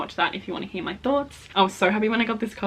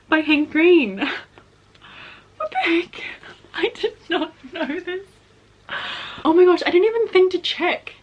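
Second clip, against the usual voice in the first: very high, loud, squealing delight; real excitement; 7 semitones higher, 5 dB louder than usual. Real, not acted. Vlogger discovering the book is signed to her, squealing excitement. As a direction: squeal it like you just found treasure. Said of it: "I'm freaking out".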